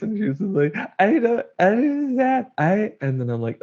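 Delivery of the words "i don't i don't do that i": high, wailing imitation of a crying mother; imitating mom sobbing. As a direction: wail it like his sobbing mom, much higher. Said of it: "like, snot dripping out her face".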